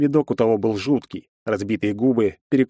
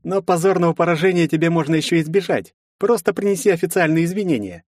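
higher, lightly voiced smug rival; arrogant demand; 8 semitones higher than usual. A narrator's reading. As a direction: lecture him from above, mocking. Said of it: "соперник требует извинений". (надменно, свысока)

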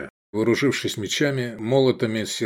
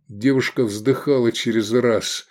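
plain reading voice

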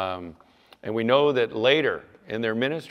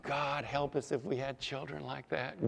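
softer, held-back remark; hushed aside; 3 semitones higher, 9 dB quieter than usual. Real, not acted. First take, set against next line.

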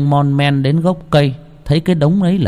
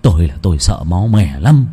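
low, halting, scared stammer; stammering in fear; 5 semitones lower than usual. A narrator's reading. Terrified old man stammering to his friend, trembling. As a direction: stumble over the words, frightened. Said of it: "lắp ba lắp bắp".

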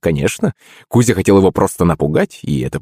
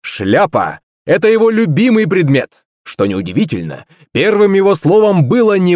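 high, grand stage introduction; excited announcer presenting a star performer; showman host; 13 semitones higher than usual. Acted. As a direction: build up the next act for the crowd. Host introduces the next illusionist.

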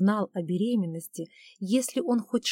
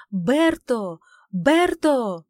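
higher, loud scream of the name; 4 semitones higher, 9 dB louder, much slower than usual. Acted. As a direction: scream the name with all her might. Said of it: "закричав, что есть мочи".

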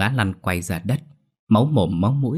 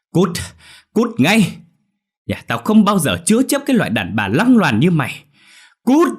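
much higher, louder screaming; shouting get out; 10 semitones higher, 7 dB louder than usual. Acted. Mother-in-law dragging the bride out the door, screaming. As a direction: scream her out of the house.

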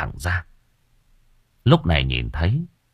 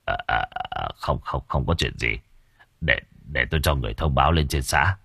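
quiet, shaken stammering, much lower; 6 semitones lower, 7 dB quieter than usual. Acted. Village chief to the villagers, hiding his terror in a hushed stammer. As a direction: swallow the fear and stumble over the words, low and hushed. Chief sees corpse hand.

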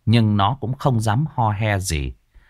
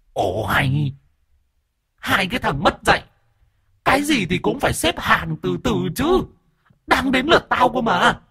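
much higher, scolding ghost woman; shrill indignant ghost; 11 semitones higher than usual. A narrator's reading. Possessed ghost scolding the two men, much higher and indignant. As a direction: squeal at them to wait their turn. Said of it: "chồm lên chỉ mặt Đồng Già".

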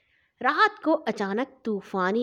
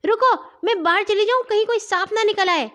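high, louder, hurried alarm; frightened cry; 10 semitones higher, 5 dB louder, faster than usual. A narrator's reading. Girl calls to the others for fear of a snake, mildly panicked cry. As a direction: call out in a mild panic, pitched well up. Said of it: "Hania چیخی".

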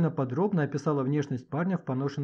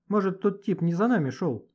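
hopeful question, lifted in pitch; a touch of hope, pitched up; 5 semitones higher, 4 dB louder than usual. Acted. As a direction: ask it with cautious hope. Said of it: "с надеждой спросил Гошка".

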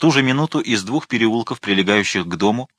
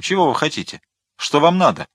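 ordinary delivery

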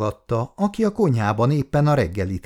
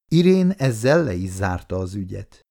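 ordinary delivery, nothing marked

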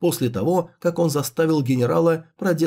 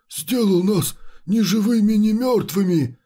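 ghostly chimera voice; 6 semitones higher than usual. Acted. Chimera complaining voice.

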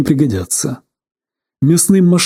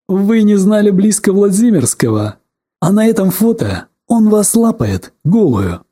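angry; 6 semitones higher than usual. A narrator's reading. Interrogator accusing.

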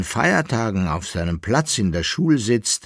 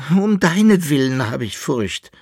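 jammernd; 6 semitones higher, 5 dB louder than usual. A narrator's reading. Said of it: "jammerte der Jäckele-Narr".

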